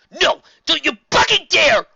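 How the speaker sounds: loud high angry shout; cursing. A narrator's reading. Rainbow Dash angrily curses at Twilight. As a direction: shout the threat. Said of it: "Rainbow Dash cursed".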